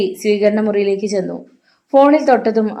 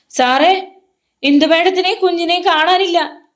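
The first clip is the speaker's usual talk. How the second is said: high panicked cry; 9 semitones higher than usual. A narrator's reading. Rosie tells Hari the madam is missing, panicked. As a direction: blurt it out in alarm. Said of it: "റോസിയുടെ പരിഭ്രാന്തമായ ശബ്ദം".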